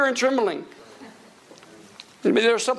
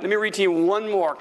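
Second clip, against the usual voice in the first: ordinary talk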